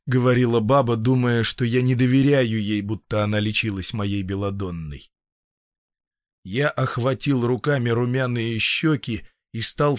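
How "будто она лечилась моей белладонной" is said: ordinary delivery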